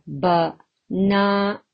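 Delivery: "Banana" slowly split, BA, NA, NA; syllable by syllable. Shown as a demonstration. Teacher counting syllables for learners, slowly broken into three. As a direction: pause between each syllable of Banana. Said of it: "How many syllables are there?".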